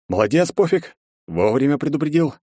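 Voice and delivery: sarcastic. Acted. Sarcastic praise for a late warning.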